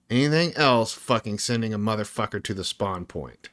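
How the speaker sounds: usual speaking voice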